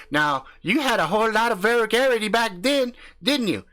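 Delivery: brash country husband hyping up his channel; cocky backwoods YouTuber swaggering through the intro; crude hillbilly husband. Acted. Husband recalls gonorrhea scare.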